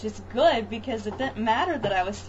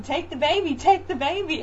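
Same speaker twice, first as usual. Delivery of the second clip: urgent plea; 7 semitones higher, 4 dB louder than usual. Retold or imitated. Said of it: "I'm telling him".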